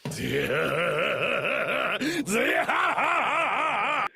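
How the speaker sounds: drawn-out warbling wail, climbing in pitch; long high wavering cry. Real, not acted. Streamer overwhelmed by the reveal, wavering wail. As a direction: wail in disbelief, rising and quavering.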